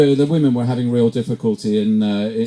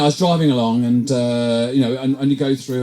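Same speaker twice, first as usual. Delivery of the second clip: normal talking voice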